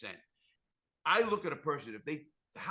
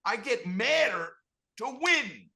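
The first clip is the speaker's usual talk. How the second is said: higher, louder and slow, driving each word home; emphatic declaration; 11 semitones higher, 11 dB louder, slower than usual. Real, not acted. To the host, firing up about winning instead of staying bitter, loud and slow. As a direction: declare it like a fighter's vow.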